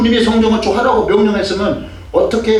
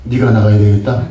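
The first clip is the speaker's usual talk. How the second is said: low, loud, stressed 네가 with a rise; deep commanding voice of God stressing 네가; voice of the Lord; 7 semitones lower, 6 dB louder than usual. Retold or imitated. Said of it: "주님이 저보고 그래요". (낮고 크게 '네가'를 힘주어 말하는 주님 목소리)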